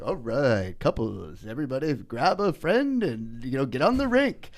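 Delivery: mock skating-rink MC starting low; rink announcer. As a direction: call couples onto the rink like an announcer. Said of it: "especially when they would be like".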